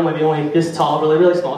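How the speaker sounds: ordinary delivery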